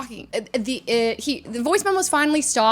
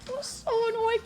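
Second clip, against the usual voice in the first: high, very soft squeak; exasperated; 10 semitones higher, 9 dB quieter than usual. Retold or imitated. Seatmate recalling the annoying man, soft exasperation. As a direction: squeeze it out softly, worn down.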